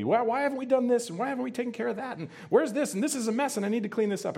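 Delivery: high, quick, flustered busy hostess voice; high and hurried, scolding about the mess. Retold or imitated.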